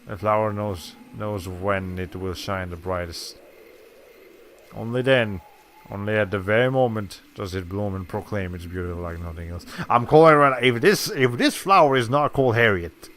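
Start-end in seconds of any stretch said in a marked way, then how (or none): 0.1-9.6 storybook narration. Acted. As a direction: hush it like a storybook passage. Reads game text aloud.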